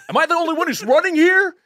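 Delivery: high, indignant mock complaint; mad Pocket Santa. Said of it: "he was, like, mad".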